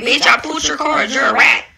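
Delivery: ordinary delivery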